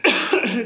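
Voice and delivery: choking cough; sharp cough from choking. Real, not acted. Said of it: "Excuse me, choking on plum".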